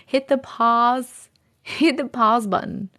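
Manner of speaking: careful "pahz" for "pause"; effortful "pahz" in "pause"; other people's pronunciation. Shown as a demonstration. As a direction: practise "pause" with an open "ah". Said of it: "that's really hard".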